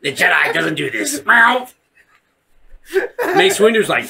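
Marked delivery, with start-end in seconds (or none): 1.2-1.7 high, loud saber swish; imitated lightsaber. Guessing how Darth Maul was cut, imitated lightsaber noise. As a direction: mouth a quick saber swing.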